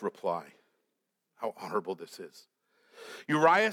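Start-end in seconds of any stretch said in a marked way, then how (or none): 1.4-2.5 sarcastic aside. Real, not acted. Sarcastic aside, soft and fast.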